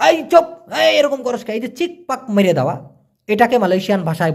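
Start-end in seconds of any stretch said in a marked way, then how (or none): 0.1-1.5 high, sharp scolding shout; mock angry yell to hush someone; imitated yell. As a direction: bark it like yelling at a noisy kid. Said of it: "জরিত মানে হচ্ছে চিৎকার করা".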